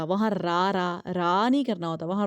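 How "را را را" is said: "را" said thin, with a light open 'raa'; a flat-tongued thin "را", vowel as in 'aa'. Shown as a demonstration. Teacher showing pupils the wrong reading, thin ra. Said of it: "وہاں باریک نہیں پڑھنا ہوتا".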